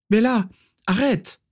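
high-pitched pleading shout; urgent cry. A narrator's reading. Régulus shakes Bellatrix to stop her, high urgent cry.

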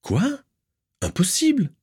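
high sharp cry of disbelief; stunned denial; shocked disbelief. Acted. Narcissa hearing her sister fled, shocked high cry.

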